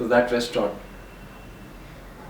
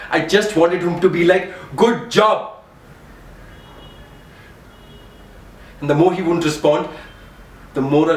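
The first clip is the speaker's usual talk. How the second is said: higher and louder, straining; emotional outburst; 4 semitones higher, 5 dB louder than usual. Real, not acted. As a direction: let it pour out, nearly breaking.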